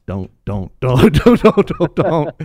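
loud, rising string of don'ts; frantic pleading. Retold or imitated. Commenters begging David not to fight, loud frantic pleading.

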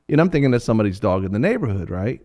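ordinary talk, nothing marked

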